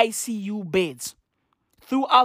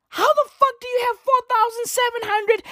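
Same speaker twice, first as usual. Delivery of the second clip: outraged; 12 semitones higher than usual. Real, not acted. Outraged at bed count.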